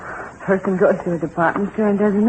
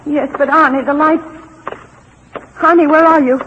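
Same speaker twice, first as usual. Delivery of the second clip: higher, louder panicked call in the dark; frightened call; 5 semitones higher, 4 dB louder than usual. Acted. Wife calls for husband in the dark tunnel, panicked. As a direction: call out for him, scared.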